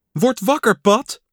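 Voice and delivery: piping, lightly insistent call; Frog's high voice. A narrator's reading. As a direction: rouse a sleeping friend. Frog wakes sleeping Pat.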